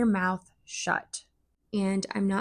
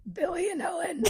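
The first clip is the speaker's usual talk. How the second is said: high squeaky granny voice; silly high old-lady voice; 9 semitones higher, 5 dB quieter than usual. Pretend. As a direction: put on a cackling old-woman voice. Inventing silly fake names.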